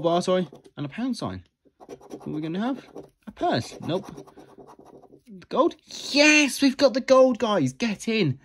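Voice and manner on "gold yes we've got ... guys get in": thrilled shout of a win; excited. Real, not acted. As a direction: burst out cheering, high and loud. Revealing a gold win.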